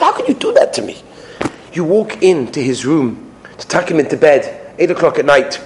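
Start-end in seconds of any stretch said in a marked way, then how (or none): 0.0-1.0 high-pitched mock indignation; upset parent. Parent scolding a naughty son, high upset protest. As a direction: act out an exasperated parent. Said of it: "You're so upset with him".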